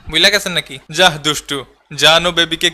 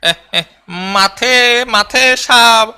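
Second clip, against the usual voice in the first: very slow, higher, stumbling over the names; stumbling name reading; 3 semitones higher, much slower than usual. Shown as a demonstration. A player fumbles through the Sri Lanka squad, slow and higher. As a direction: struggle syllable by syllable through each name. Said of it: "পইরা শোনা বেড়া".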